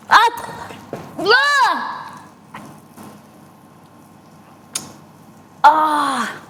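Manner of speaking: sharp high yelps of pain. Real, not acted. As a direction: yelp from a burn. Burned by molten jam.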